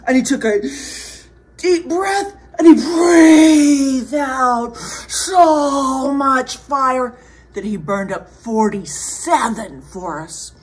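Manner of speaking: put-on big drawn-out telling for children; animated storyteller, loud swell falling away; dramatic story narration. A narrator's reading. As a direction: act out the huge breath, then settle down. Dramatic narration of fire breath.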